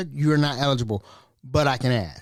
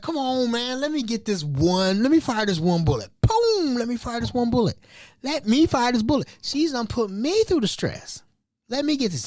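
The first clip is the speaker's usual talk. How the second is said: mock pleading; 8 semitones higher than usual. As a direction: beg the boss for one shot. Begging boss to let him fire her.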